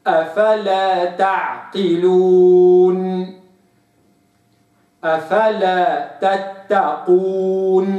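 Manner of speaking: أفلا تعقلون very slow and clear; correct recitation. Shown as a demonstration. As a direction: recite slowly. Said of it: "وإنما". (تلاوة صحيحة متأنية)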